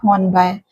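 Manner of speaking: Hornby said as "Horn-bye"; Hornby with its last syllable rhyming with "bye"; rejected pronunciation. Shown as a demonstration. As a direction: end Hornby on an "eye" sound, as the wrong version. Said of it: "the pronunciation is not Hornby".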